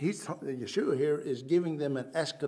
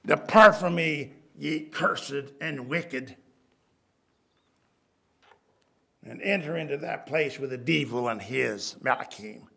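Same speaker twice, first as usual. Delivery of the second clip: stern judgment; 3 semitones lower, 5 dB louder than usual. Acted. Preacher voicing Christ's condemnation.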